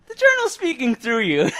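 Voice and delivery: spooky excited. Pretend.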